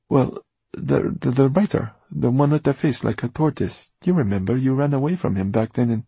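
high, loud, stumbling explanation; eager, flustered young man. Acted. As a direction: hurry to explain, tripping at the start. Flustered boy explains himself.

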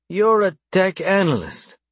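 drawn-out, high-pitched, considering remark; slow, mulling. A narrator's reading. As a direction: weigh each word as you work it out. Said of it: "he said slowly, mulling over the information".

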